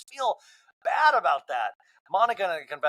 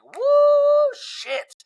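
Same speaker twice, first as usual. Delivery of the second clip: high loud whoop then a breathless curse; shocked woo; 8 dB louder than usual. Retold or imitated. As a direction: squeal it out, then drop to a hissed curse. Own shocked reaction to fight.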